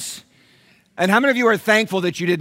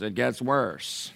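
quiet deadpan; 5 semitones lower, 8 dB quieter, much slower than usual. Retold or imitated. Retold Dave deadpan line.

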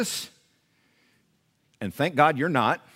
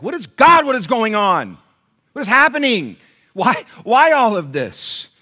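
high, loud cry of bewilderment; distressed questioning; 7 semitones higher, 10 dB louder than usual. Retold or imitated. Doubting churchgoer crying out to God, distressed and loud. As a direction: act out an exasperated doubter crying out. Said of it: "some of you are here and you're like".

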